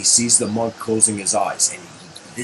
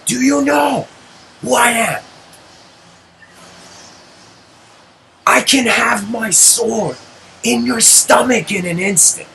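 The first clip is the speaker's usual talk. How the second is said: savage warlord shout; 11 semitones higher, 3 dB louder than usual. Retold or imitated. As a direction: threaten him like a tyrant. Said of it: "shouts, and he says".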